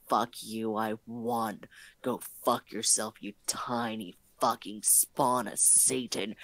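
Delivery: low, smug victory snarl; gloating thought. Retold or imitated. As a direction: silently sneer at the beaten kid. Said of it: "I feel this sense of".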